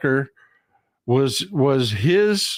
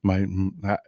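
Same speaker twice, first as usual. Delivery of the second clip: ordinary talk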